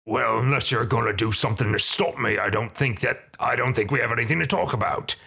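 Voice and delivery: put-on thug voice, dismissive; hobgoblin leader. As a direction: brush off the priest as a hobgoblin boss. Hobgoblin dismisses the priest's protest.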